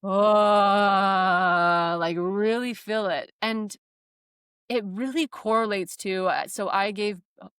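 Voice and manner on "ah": loud freeing ah from the belly; long loud release ah; loud open exhale. Shown as a demonstration. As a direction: let the sound out fully. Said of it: "I have to be loud".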